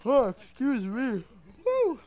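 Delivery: high stretched yawn through words. Real, not acted. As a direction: talk through a big yawn. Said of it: "Yawning there".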